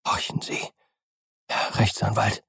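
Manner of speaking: hushed, urgent whisper; barely voiced, secretive aside. A narrator's reading. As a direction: drop to a soft whisper, as if listening out. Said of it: "flüsterte Poole".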